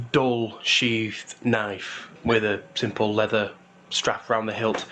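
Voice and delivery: ordinary delivery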